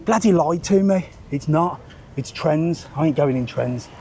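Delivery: ordinary delivery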